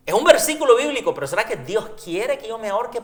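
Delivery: incredulous outburst; incredulous exclaim. Real, not acted. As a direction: burst out in disbelief. Amused indignation at absurdity.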